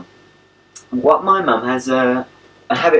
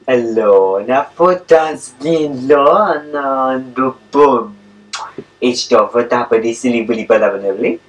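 slow, drawn-out nonsense talk to a dog; stretched-out doggy gibberish; playful gibberish; 4 dB louder than usual. Shown as a demonstration. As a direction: babble silly made-up words to a pet. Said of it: "likes nothing better than my gibberish".

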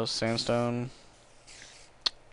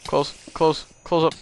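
panicked; 7 semitones higher, 6 dB louder, slower than usual. Real, not acted. Rushing to shut door.

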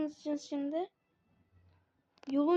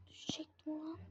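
whispering; 15 dB quieter than usual. Real, not acted. Near fall in game.

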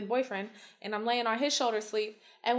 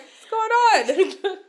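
laughing confusion; 9 semitones higher, 9 dB louder, much slower than usual. Retold or imitated. Waking up confused.

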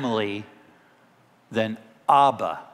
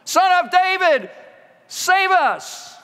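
loud, high-pitched scream for help; crying out; 4 dB louder, slower than usual. Acted. Preacher as two blind men calling to Jesus, screamed cry. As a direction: yell it desperately across a crowd. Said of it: "they cried out".

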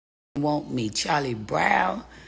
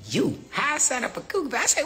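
ordinary talk, nothing marked

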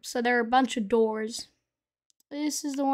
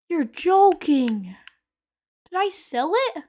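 drawn-out disbelief; 5 semitones higher, 7 dB louder, slower than usual. Real, not acted. Item missing in game.

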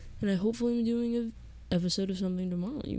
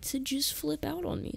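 voice crack; 8 semitones higher, 5 dB quieter than usual. Real, not acted. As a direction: voice cracks up high and softer. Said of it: "voice crack?".

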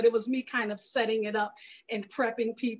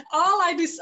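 laughing, louder and higher; 6 semitones higher, 6 dB louder than usual. Real, not acted. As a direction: laugh it off a bit sheepishly. Guest laughs at her own overload.

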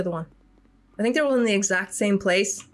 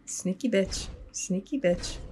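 softer, under the breath; muttered insult; 7 dB quieter than usual. Real, not acted. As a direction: grumble it quietly. Muttered at enemy.

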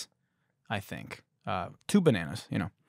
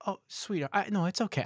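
lightly voiced woman, higher and softer; mock sweet woman; 8 semitones higher, 4 dB quieter than usual. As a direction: imitate a woman gently declining. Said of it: "And she's like".

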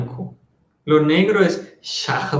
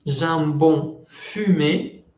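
"jambon fumé" said slowly and carefully; slow teacher demo of "jambon fumé"; slow model phrase; much slower than usual. Shown as a demonstration. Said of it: "es el jamón ahumado".

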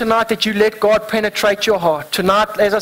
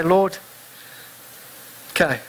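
softer, yielding prayer, trailing down; quiet surrender; 4 semitones lower, 8 dB quieter than usual. Pretend. Believer surrendering to the Lord, soft and yielding. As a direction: give it up to God softly.

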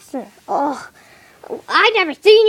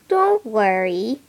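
usual speaking voice

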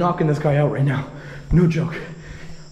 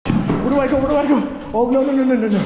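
high, frantic rapid repeats; real panic; 9 semitones higher, 4 dB louder than usual. Real, not acted. Man cornered while fleeing, panicked cries. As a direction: cry out, desperate for an escape.

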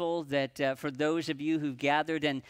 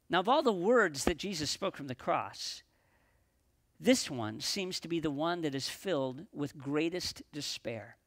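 ordinary delivery

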